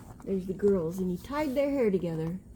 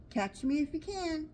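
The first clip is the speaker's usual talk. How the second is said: mild mock shout; 6 semitones higher than usual. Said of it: "gingerbread baby shouting".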